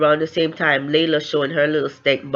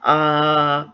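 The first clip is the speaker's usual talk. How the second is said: long, level, stuck "uh" sounds; mock lost-for-words hesitation; imitated panicked stalling; much slower than usual. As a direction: act out stalling for an answer. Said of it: "her ass was panicking 'cause she's like".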